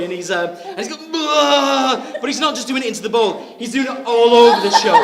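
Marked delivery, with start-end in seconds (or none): 1.1-2.0 loud, high retching groans; mock retching. Drunk friend being sick in the toilet, loud mock retching groans. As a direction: heave it out over the bowl. John vomiting again.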